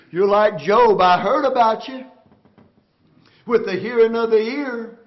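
loud, driving preacher cadence; full-force preaching. Acted. Preacher pressing the church on being undone, full-force preaching. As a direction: preach it hard to the congregation.

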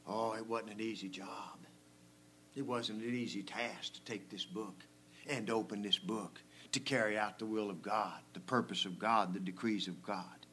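low, soft, grave preaching; hushed solemnity. Real, not acted. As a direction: drop right down to a hushed, weighty tone. Solemn aside on the costly task.

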